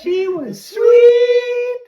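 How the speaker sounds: very high, slow laughing line; laughing villain quote. Retold or imitated. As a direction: squeal it out through laughter.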